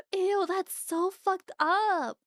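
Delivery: appalled, slow reaction; disgusted. Real, not acted. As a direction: stretch it out, appalled.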